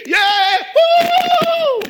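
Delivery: very high, loud shrieking woo; cheerleader whoop. Retold or imitated. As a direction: cheer from the stands.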